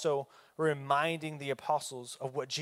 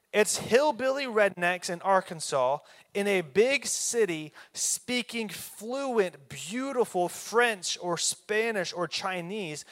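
louder and higher, fired-up preacher pressing the point; preaching at full force; 4 semitones higher, 6 dB louder than usual. Real, not acted. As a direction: drive it home to the congregation, building up.

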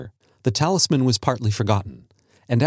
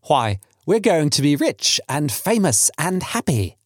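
slow, higher-pitched mock dismay; pretended horror; 5 semitones higher than usual. Son teasing about being rich, mock horror. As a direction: stretch out the riches in feigned horror. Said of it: "said Herbert with pretended horror".

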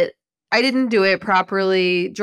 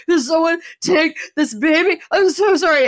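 higher, louder mock plea to take the baby; imitating a friend begging while gagging; pleading friend; 9 semitones higher, 5 dB louder than usual. Pregnant friend begging someone to take the baby, lightly imitated.

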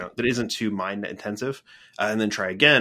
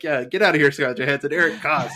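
excited dismissal; 5 semitones higher, 4 dB louder, faster than usual. Retold or imitated. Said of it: "I would be like".

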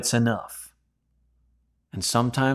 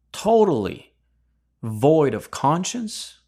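stern rebuke, higher and slowed; rebuking criminal; 4 semitones higher than usual. Acted. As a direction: scold him for not fearing God. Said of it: "and rebuked".

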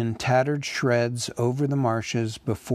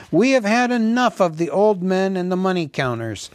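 fast, high, loud crowd complaint; shouted protest; 7 semitones higher, 5 dB louder, faster than usual. A narrator's reading. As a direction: shout back as a fed-up crowd. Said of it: "shouted in reply".

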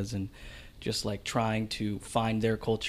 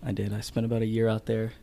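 usual speaking voice

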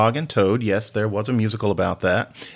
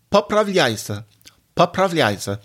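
higher-pitched Russian phrase; voice going up; 6 semitones higher than usual. Real, not acted. Teacher modelling Russian for listeners, voice going up. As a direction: lift the voice high for the Russian. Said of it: "my voice is going up for Russian".

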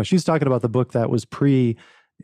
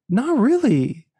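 animated retelling; 7 semitones higher than usual. Retold or imitated. Retells confident refusal.

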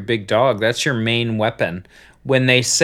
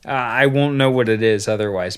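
normal talking voice

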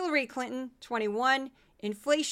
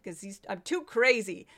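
ordinary talk, nothing marked

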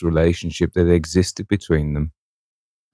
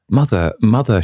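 high, louder cry of mother; 6 semitones higher, 4 dB louder than usual. A narrator's reading. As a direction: call out to her mother, overcome. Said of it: "she cried".

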